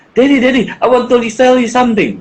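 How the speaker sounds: put-on a light kid voice, eager; child voice. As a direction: imitate a child tugging for attention. Child's request retold.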